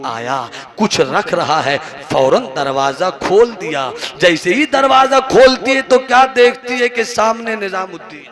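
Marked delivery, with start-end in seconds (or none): 4.2-8.3 rising excitement. Acted. Preacher building to climax.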